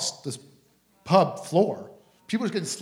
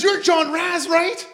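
much higher, louder, eager; excited recognition; 12 semitones higher, 6 dB louder, much slower than usual. Retold or imitated. Daughter recognizing the famous preacher, high eager voice. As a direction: ask it thrilled to meet him.

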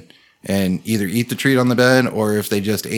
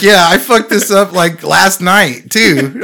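excited retelling; 8 semitones higher, 8 dB louder, slower than usual. Retold or imitated. Quoting friend confessing, excited.

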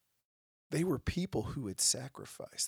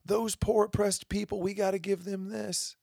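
mock screaming; 9 semitones higher than usual. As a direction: drag out the demands in a high, needy voice. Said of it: "So many people screaming".

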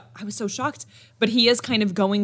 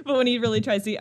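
ordinary talk

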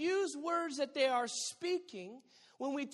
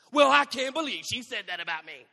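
much louder, hammed-up offended voice; mock outrage; 13 dB louder than usual. Preacher mocks someone offended by praise, loud hammed-up outrage. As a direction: play the offended friend, big.

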